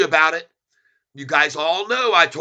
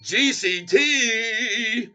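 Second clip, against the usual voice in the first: victory whoop; 8 semitones higher, much slower than usual. Real, not acted. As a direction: hype the crowd up. Celebrating stock with music.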